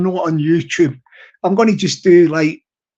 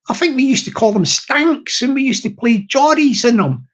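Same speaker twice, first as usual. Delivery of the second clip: ordinary talk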